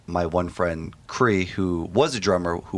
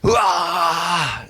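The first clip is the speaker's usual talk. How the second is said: wrong-way scream; 10 semitones higher, 5 dB louder than usual. Shown as a demonstration. Vocal student showing how not to scream, high raw yell. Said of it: "if you're screaming like".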